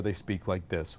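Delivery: very low, soft, open-throated chest voice; on-air announcer voice. Shown as a demonstration. Teacher shows announcers on the air, deep open-throated voice. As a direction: drop deep into a radio chest voice. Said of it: "When they're on the air".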